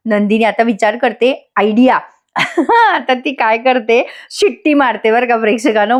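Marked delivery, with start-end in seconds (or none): 2.3-2.9 short, high-pitched laugh; amused laugh. Real, not acted. Reviewer tickled by Nandini's idea, amused laugh.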